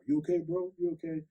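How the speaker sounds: calling through the door. Retold or imitated. Vietnam vet neighbor knocking, calling out high and concerned. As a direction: call out, worried, through a closed door.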